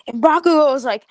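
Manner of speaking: ordinary talk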